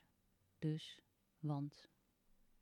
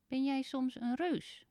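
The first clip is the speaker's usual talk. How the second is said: little man's voice; 7 semitones higher, 5 dB louder than usual. Acted. Little man asks Teun.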